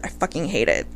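a low soft hate-it; quiet disgust. Real, not acted. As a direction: say it under your breath, pained. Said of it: "it literally hurts my heart".

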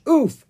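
short, high, loud oof; comic grunt on a hard landing; impact oof. A narrator's reading. Bouncing down a hill.

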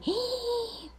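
sharp, high theatrical gasp; mock gasp of heartbreak; shocked gasp. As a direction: gasp as if stabbed by the news. Shock at being left out.

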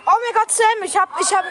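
loud excited burst. Real, not acted. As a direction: blurt it out excitedly. Excited exclamation.

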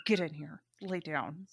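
low, quiet mock command; retold hushed order. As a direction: order them under your breath. Retold order to reluctant friend.

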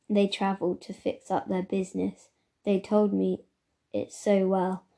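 ordinary delivery

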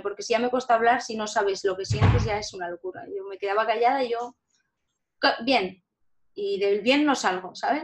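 usual speaking voice

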